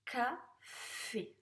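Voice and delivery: "Café" as ca, pause, fffé; syllable-by-syllable reading. Shown as a demonstration. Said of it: "Allez, on corrige". (« Café » lu en deux syllabes, plus posément)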